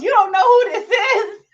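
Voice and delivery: loud, high, laughing outrage; mock-offended yell, laughing; mock indignant shout. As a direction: shout it in disbelief. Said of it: "He goes".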